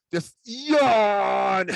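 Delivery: loud drawn-out mock cry; cartoonish sudden holler; sudden yell. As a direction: burst out with a long, loud cry. Acting out himself in the game.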